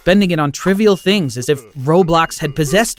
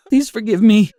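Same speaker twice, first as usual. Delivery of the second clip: higher, tearful murmur, less pushed; mumbling through tears; 7 semitones higher, 4 dB louder than usual. Acted. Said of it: "I started mumbling through my tears".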